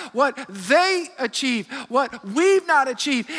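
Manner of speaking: normal talking voice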